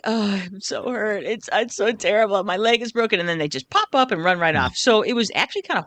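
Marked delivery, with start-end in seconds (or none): 0.0-3.2 mock a diver's whimpering; mock whining. Mocking divers' fake pain, a light hammed-up whine. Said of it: "they put on this act like".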